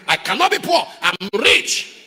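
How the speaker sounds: mock the proud rich pastor; mocking boast. Preacher mocking a boasting pastor, laughing boast. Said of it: "Yama says".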